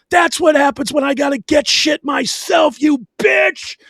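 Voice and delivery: high, loud screaming rage; husband raging at his wife after smashing the remote; furious shout. Retold or imitated. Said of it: "And I was like".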